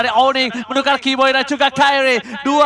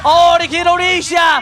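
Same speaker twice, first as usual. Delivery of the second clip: fighting game announcer; 7 semitones higher, much slower than usual. Real, not acted. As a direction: stretch out the kill call at full volume. Triple kill hype call.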